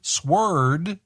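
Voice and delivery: sword rhyming with word; misread as spelt. Shown as a demonstration. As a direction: say sword as SWURD, rising. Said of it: "how do you say that".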